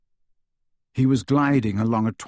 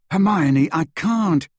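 louder, higher retort; exasperated protest; 6 semitones higher, 6 dB louder, slower than usual. A narrator's reading. Harry defending himself to Hermione, exasperated. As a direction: push back with raised voice.

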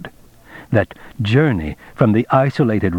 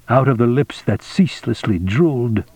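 ordinary delivery

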